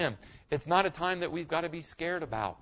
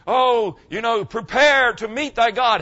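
slow, loud, ominous fire-and-brimstone warning; mock doom preacher; 6 semitones higher, 9 dB louder, slower than usual. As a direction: ham up the scary old-time preacher. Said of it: "people mean".

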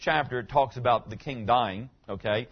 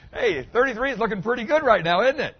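higher, louder mock teen voice; lightly voiced eager teenager; teasing imitation of teens wishing to be older; teenager impression; 9 semitones higher, 6 dB louder than usual. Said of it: "You got a bunch of teenagers going".

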